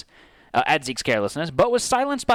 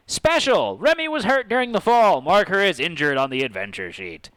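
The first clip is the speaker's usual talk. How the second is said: game announcer read; 5 semitones higher than usual. Acted.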